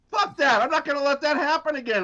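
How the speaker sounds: high, fired-up player's vow; chest-pounding defiance. Acted. Player pounding his chest after a sack, defiant. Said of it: "you pound your chest and go".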